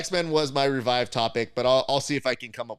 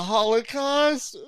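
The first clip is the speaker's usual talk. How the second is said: 1 mock outraged question; 13 semitones higher, 6 dB louder, much slower than usual. Mock Magneto reply, loud and high.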